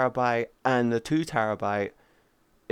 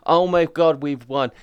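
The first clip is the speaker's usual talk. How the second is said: gloating; 5 dB louder than usual. Retold or imitated. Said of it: "laughing at everyone ... and go".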